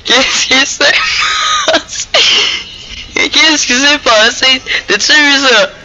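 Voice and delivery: aigu et fort, encore en riant; stupéfait, la voix qui monte; incrédulité hilare. Real, not acted. Stupéfait devant le bug. (stupéfaction aiguë)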